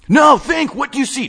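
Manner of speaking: high, loud frantic demand; yelling. Acted. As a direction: bark the order to think. Wade yells at narrator.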